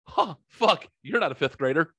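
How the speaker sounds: put-on high, startled old man's curse; startled old usher. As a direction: blurt it out in a sudden high pitch, like a caught old man. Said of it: "to, "Oh, fuck".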